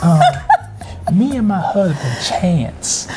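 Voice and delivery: hushed storyteller impersonation, fading as if not to wake anyone; Tabitha Brown impression. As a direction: confide gently in the viewers, with lilting little check-ins. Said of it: "That's how she talks".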